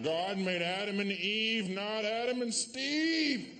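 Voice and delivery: slow, rising sing-song slogan; hammed-up preachy chant; mock protester. Comic parodying protesters, slow high mocking slogan.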